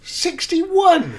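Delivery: high, loud echo of the number; can't believe the age he just heard; shocked disbelief. Real, not acted.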